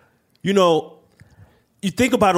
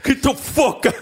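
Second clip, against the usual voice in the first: mock tough-guy yell; 12 semitones higher than usual. Joining the Aiello bit.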